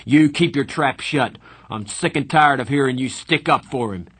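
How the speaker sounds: loud, high snapping shout; angry. A narrator's reading. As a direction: snap at him in fury. Darry turns on Soda.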